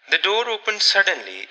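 ordinary delivery, nothing marked

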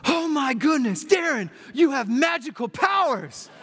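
high, loud cry of astonishment; yelling in amazement. Retold or imitated. Healed man yelling to Darren, amazed shout. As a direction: yell it out, stretched and high, amazed. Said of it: "he starts yelling".

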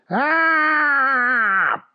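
long high "aah" sliding down; cartoon cry of a falling man; falling scream. Scared old man topples over, drawn-out cry.

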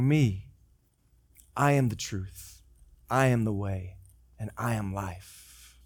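Jesus calmly answering Thomas, slow and low; solemn Jesus. Retold or imitated. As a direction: speak each phrase with weight and calm. Jesus's solemn self-declaration.

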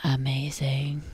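slow breathy whisper. Real, not acted. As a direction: whisper it in awe. Whispered reaction.

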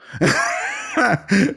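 high, drawn-out real laugh; hearty laugh. Real, not acted. Host cracking up at his own flyer story, hearty laugh. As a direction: break into helpless high laughter.

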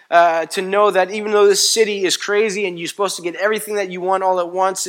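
loud, high, driving preacher's cadence; preaching at full force. Acted. Pastor rousing the congregation, full-force preaching. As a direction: hammer each not about home to the church.

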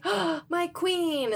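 high, slow, gasped adoration; hammed-up worshipful swoon; adoring slave. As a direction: gasp it in mock awe, drawn out. Slave greets his queen in awe.

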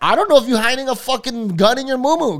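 high-pitched mock indignation; exasperated retort. Acted retort to the neighbour.

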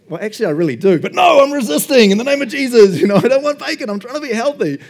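hammed-up high voice resisting the bacon; mock pious customer. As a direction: pastor playing himself refusing bacon at Subway, high mock-pious. Pastor acting his Subway order.

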